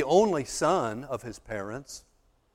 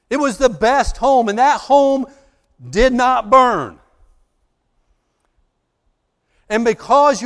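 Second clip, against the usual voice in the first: much louder and higher, driving climax; preaching at full force; 6 semitones higher, 8 dB louder than usual. Acted. Preacher proclaiming grace to the arsonist, full-force climax. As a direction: shout the grace story home.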